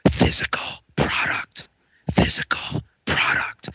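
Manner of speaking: right in your ear; whisper. Shown as a demonstration. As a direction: secret whisper into the mic. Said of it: "I'm gonna whisper into my microphone here".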